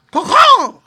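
very high, loud crow call; rascally crow caw. A narrator's reading. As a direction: screech out a crow's caw. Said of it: "It was a rascal's voice".

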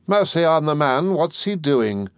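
high-pitched, exasperated exclamation; impatient cry. A narrator's reading. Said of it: "cried my aunt impatiently".

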